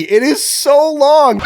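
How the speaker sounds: long, weary complaint; exasperated drawl. Real, not acted. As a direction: stretch it out in exasperation. Complaining about endless exposition.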